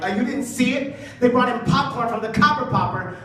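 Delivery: high-pitched, irritated coworker; mock fuming usher. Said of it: "she is fuming".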